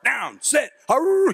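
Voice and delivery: high barked snap call; barking quarterback. Acted. Quarterback calling the play before the snap, high mock bark. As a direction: bark it like a quarterback, ending in a yell. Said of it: "They kinda bark".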